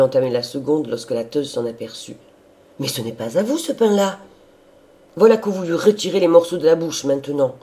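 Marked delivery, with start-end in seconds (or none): 2.8-7.6 loud, rising outraged protest; indignant scolding. A narrator's reading. Old servant catching the sister with his bread, indignant. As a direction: burst out higher and louder as you go.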